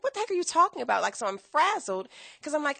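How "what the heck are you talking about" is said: much higher and fast; shocked outburst. Retold or imitated. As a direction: fire it out in disbelief. Parents refuse to help.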